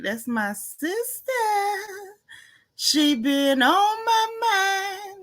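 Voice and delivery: slow, tearful words, pitch climbing high. Real, not acted. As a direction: voice catching as she gets choked up about her sister. Moved talking about her sister.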